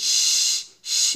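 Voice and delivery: শ as a long voiceless hiss. Shown as a demonstration. Teacher models the sound of shin, hissing শ. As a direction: let only air out on a sustained শ. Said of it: "এভাবে".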